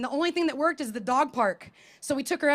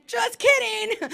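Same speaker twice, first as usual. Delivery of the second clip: mock playful; 8 semitones higher, much slower than usual. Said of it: "And then".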